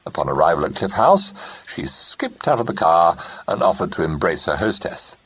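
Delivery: usual speaking voice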